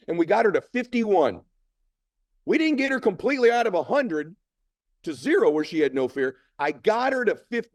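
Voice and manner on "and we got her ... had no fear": impassioned motivational speech. Real, not acted. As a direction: preach the point to a crowd.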